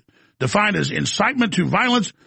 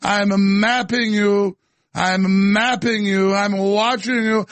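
slow, menacing mock British voice; fake British accent; 3 semitones higher, slower than usual. Host mocking Gorka's surveillance threat in fake British accent. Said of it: "with my fake British accent".

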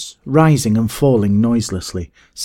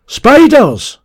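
very high, loud, falling yell; alarmed cry; 10 dB louder than usual. A narrator's reading. Gaunt man spotting spiders, alarmed cry. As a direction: cry out a sudden warning. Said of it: "cried the voice of the gaunt man".

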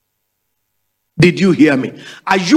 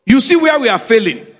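ordinary delivery

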